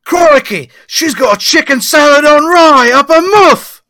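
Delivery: loud, high hammed-up Australian shout; Australian accent. Australian officer discovers the sandwich, shouting in accent. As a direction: yell it like an Australian cop. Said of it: "This is a true Australian".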